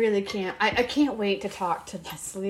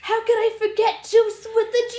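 excited; 10 semitones higher, 5 dB louder than usual. Real, not acted. Excited recall of strain.

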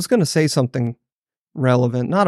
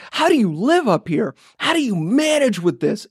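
higher-pitched, incredulous self-quote; retold exasperation; 8 semitones higher than usual. Zapped visitor asks locals how they live up here, exasperated. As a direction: recreate your baffled complaint, pitched up.

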